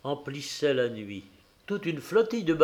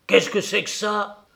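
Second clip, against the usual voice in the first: higher, louder indignant outburst; angry cry; 6 semitones higher, 5 dB louder than usual. A narrator's reading. Mother confronting her husband about the child, angry cry. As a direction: demand an answer, outraged. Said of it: "elle cria d'un ton courroucé".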